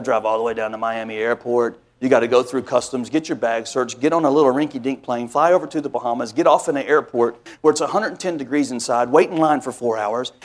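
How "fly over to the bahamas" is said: normal talking voice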